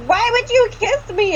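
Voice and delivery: high-pitched imitation of complaining; mock outrage. Friend replaying the girl's kiss complaint, high mock outrage. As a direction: squeal the question in mock protest. Mocking retelling of the argument.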